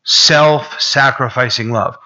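ordinary talk

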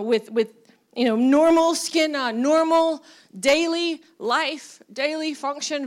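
usual speaking voice

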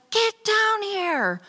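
high, slow, urgent call down the stairs; retold scream. Girlfriend calling her downstairs in panic. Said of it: "I just remember her screaming".

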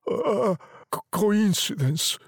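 halting, stammered reply; shaken. A narrator's reading. Old man doubting the wish, shaken stammer. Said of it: "his voice shaking".

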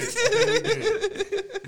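high, slow, squeezed through laughter; laughing repeat. Real, not acted. Cohost laughs while repeating his own answer, laughing. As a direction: laugh the words out.

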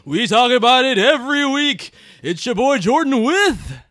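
booming slow show-intro announcer; big hyped announcer, drawn out. Acted. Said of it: "what is with this announcer voice".

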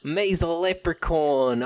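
high, slow mock Irish leprechaun; leprechaun Irish accent. Podcaster riffing on the Irish character, mock Irish leprechaun voice. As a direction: play a hammed-up Irish leprechaun. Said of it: "How do you do an Irish accent".

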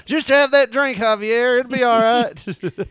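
high, loud coaxing boss voice, slow at the end; mock boss coaxing. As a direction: coax the butler with a hammed-up high voice. Acting as a boss to butler.

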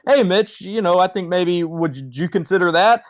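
acted cautious, polite nudge; tentative suggestion. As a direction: float it timidly, as if to the boss. Timid staffer gently suggesting to boss.

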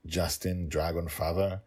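usual speaking voice

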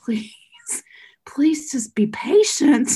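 high, slow whispered plea; hushed, slow plea to an angry woman in line; pleading whisper. Retold or imitated. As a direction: whisper the plea, begging for calm. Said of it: "and I'm like".

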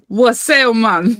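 slangy London roadman "Wassail, man"; roadman greeting. Retold or imitated. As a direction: tough street greeting, "Wassail" drawled. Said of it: "like a roadman greeting".

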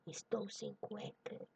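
slow breathy whisper into the ear. A narrator's reading. Husband whispering to his sleeping wife, seductive whisper. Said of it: "sussurra apaixonadamente no ouvido".

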